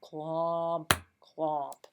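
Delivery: heavy, drawn-out steps, one by one; slow plodding. Shown as a demonstration. Teacher shows kids that plodding is slow, slow trudging beats. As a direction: plod through each word with gaps between. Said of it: "plodding is slow".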